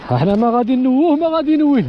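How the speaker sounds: loud call across the field. Real, not acted. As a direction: call out loudly. Calling out outdoors, loud.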